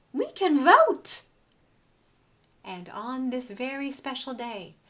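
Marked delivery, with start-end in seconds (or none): 0.1-1.2 slow, loud, high announcement; the smart dog revealing the big idea; triumphant. A narrator's reading. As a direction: proclaim the solution proudly.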